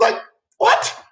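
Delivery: squeaky, very high-pitched disbelief; incredulous. Real, not acted. Host baffled at being blamed for the show, high incredulous squeak. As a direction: let "what" leap up high in bafflement.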